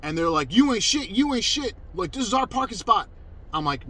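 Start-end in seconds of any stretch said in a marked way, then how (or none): 0.5-3.1 high, taunting mock aggression; pitched-up, belligerent impersonation; imitated hostility. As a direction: mimic a hostile group squaring up. Acted quote of hostile newcomers.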